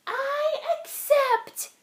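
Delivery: a dainty tree spider speaking up; tiny spider voice. Acted. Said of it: "said the spider".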